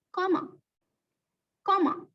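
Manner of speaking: Comma said clearly as KOM-uh; saying comma. Shown as a demonstration. Teacher modelling the word for pupils, clearly. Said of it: "How do we say this little thing".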